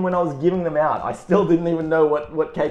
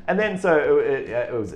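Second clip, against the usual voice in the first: normal talking voice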